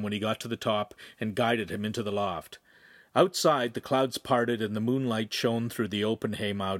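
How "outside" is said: ordinary delivery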